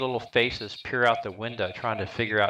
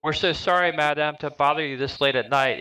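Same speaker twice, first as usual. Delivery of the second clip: higher, louder polite opening; polite apology; 7 semitones higher, 4 dB louder than usual. Acted. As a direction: apologize sweetly to the lady at the door. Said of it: "Arena beats you guys to the punch and says".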